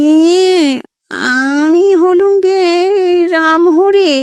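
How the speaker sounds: high, slow nasal whine of a ghost; high nasal ghostly voice. A narrator's reading. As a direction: play a timid ghost speaking through the nose. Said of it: "খোনা স্বরে জবাব এলো".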